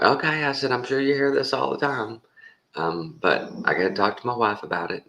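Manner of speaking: high, polite put-off; polite brush-off. Retold or imitated. Caller putting the salesman off to ask his wife, in a high polite voice. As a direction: beg off politely. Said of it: "I'm like".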